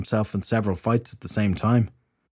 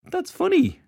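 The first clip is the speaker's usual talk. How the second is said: high-pitched, tipsy amusement; amused, woozy woman; slower than usual. A narrator's reading. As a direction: play her giddily amused, voice lifted high. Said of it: "Alice started to laugh".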